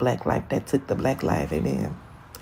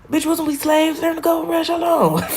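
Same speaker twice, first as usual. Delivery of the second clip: much higher, disbelieving outburst; shocked; 13 semitones higher than usual. Real, not acted. A vlogger realizing something about history, shocked and high. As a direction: catch yourself mid-realization. Realizing slaves during gold rush.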